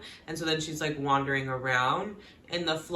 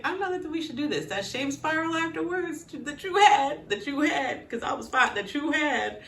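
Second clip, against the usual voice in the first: high, fast mocking retort; mock high John; 9 semitones higher than usual. Quoting John, a high mocking whine. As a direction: whine his line back at her. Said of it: "And he's like".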